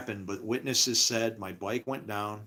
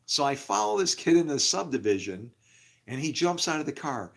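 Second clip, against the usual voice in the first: ordinary talk, nothing marked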